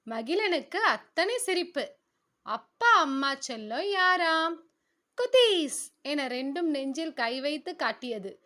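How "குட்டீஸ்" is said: put-on high, loud kids' answer; two little kids proudly answering their father, high and loud; children's chorus. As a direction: chirp it out like happy little kids.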